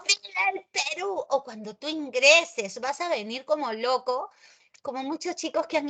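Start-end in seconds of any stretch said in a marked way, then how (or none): 0.1-1.2 high, fervent cheer for the nation; patriotic cheer. Acted. Teacher voicing the national cheer, high and excited. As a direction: cry it out like a proud fan. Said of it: "cada vez que decimos".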